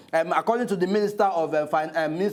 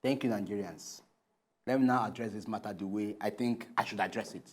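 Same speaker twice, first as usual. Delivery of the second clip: low, very soft, measured; resume quietly after the row; subdued composure; 9 semitones lower, 10 dB quieter than usual. Acted. Co-host turns back to Nigerians, subdued and composed.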